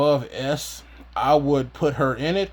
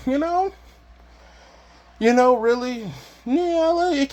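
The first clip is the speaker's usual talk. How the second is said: squeaky high voice; 12 semitones higher, slower than usual. Real, not acted. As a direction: pitch jumps way up, squeaky. High squeaky filler talk.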